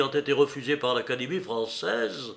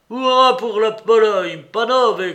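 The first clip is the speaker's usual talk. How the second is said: loud, high hurrah; cheering cry; 8 semitones higher, 11 dB louder than usual. A narrator's reading. Mitya raises his glass to Poland, crying out. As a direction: cheer it out as a toast. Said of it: "cria Mitya en levant son verre".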